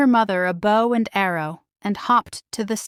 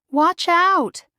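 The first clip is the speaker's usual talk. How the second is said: high, loud warning; warning cry; 9 semitones higher, 4 dB louder than usual. A narrator's reading. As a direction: shout a warning to protect your mother. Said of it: "Merida cried".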